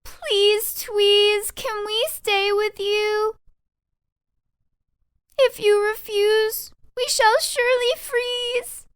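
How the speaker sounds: very high, loud, slow begging; pleading mice. Acted. Mice begging Tweez for shelter, high and pleading. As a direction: beg desperately to come in. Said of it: "Then all the mice cried".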